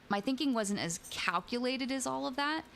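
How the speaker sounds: ordinary delivery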